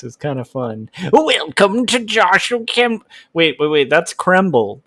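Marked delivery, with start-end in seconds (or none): 1.1-3.0 much higher, slow mock demon; hammy evil greeting. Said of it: "I'm enjoying the demon voice".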